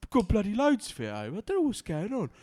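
out of touch dad. Retold or imitated. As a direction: mimic a clueless grumpy dad. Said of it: "Do you know what you sounded like then? You sounded like an out of touch dad".

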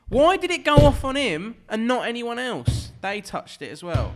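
loud, high-pitched protest; exasperated rant. Real, not acted. Arguing with co-hosts about the film's plot, exasperated.

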